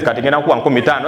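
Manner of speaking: fast, low Luganda reply; quick low local answer. Retold or imitated. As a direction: rattle off the price like a local.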